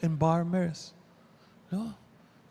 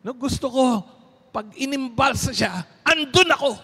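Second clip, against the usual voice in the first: insistent; 8 semitones higher, 5 dB louder than usual. Retold or imitated. Priest insists on being present.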